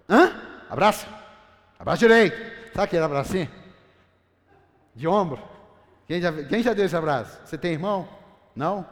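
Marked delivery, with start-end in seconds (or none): 0.1-5.4 loud stern father demanding a proper hug; father's stern order. Retold or imitated. Father ordering his fighting sons to hug, loud and stern. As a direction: bark it like a dad ordering the kids to hug right.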